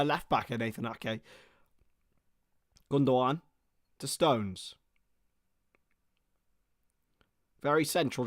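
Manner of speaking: steady live match commentary; play-by-play. Acted. Commentator calling the match for viewers, play-by-play. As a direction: narrate each pass as it happens.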